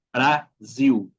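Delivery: "Brasil" ending in iu, bra-ziu; final L as U. Shown as a demonstration. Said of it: "Cuando está al final de la sílaba". ("Brasil" con la L final pronunciada como U)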